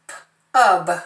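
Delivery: P-ub split into p and ub; teacher segmenting a closed syllable; segmented syllable. Shown as a demonstration. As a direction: break Pub into its sounds. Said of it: "That's a closed word".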